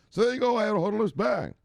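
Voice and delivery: high-pitched, excited show closer; hyped sign-off. Pretend. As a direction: fire off a triumphant catchphrase ending. Mock sign-off, thinking story ended.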